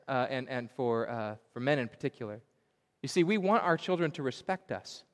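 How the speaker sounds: ordinary talk, nothing marked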